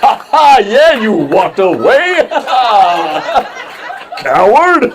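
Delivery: loud, high jeering laugh and taunt; mocking taunt. Comic imitating someone jeering at him for walking away, loud high mocking taunt. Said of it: "mocking voice of heckling crowd".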